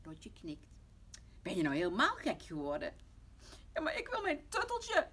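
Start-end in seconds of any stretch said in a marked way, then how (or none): 1.4-2.9 loud, outraged mother; angry scolding. Acted. Mama scolds Lotje for cutting the nightgown, angry. 3.7-5.1 put-on very high, loud toddler wail; tearful shout. Tommy cries out for his tutteltje, tearful shout. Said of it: "roept Tommy huilend".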